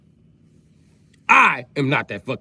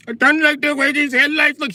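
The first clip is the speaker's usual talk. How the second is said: put-on Indian accent; 9 semitones higher, 4 dB louder than usual. Indian woman complaining.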